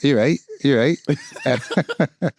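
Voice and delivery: mock Lancashire greeting with a Blackburn twang; Lancashire accent. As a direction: wind up a friend with a broad Lancashire accent. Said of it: "still talk like that".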